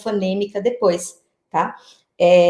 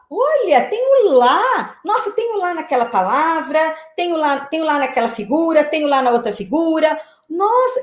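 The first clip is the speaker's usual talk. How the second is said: amazed discovery; 5 semitones higher than usual. Acted. Teacher playing a child who finds the same syllable everywhere, amazed discovery. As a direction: marvel at every new find, voice leaping up.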